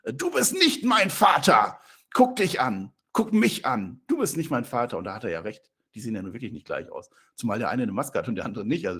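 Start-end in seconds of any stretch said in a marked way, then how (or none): 0.0-5.0 slow, loud, high yell of a defiant son; shouted accusation. Acted. Son yelling at his masked father, loud and slow. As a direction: hurl it at him like a furious teenager. Voicing Dominik yelling at Ray.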